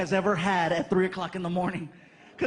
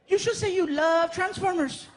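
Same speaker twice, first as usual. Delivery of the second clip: put-on kid's question; 9 semitones higher than usual. Frankie seeing the cakes, high excited kid voice. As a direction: ask like an amazed little boy.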